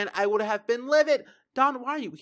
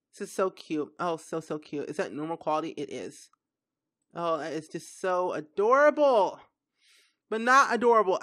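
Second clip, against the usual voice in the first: ordinary talk, nothing marked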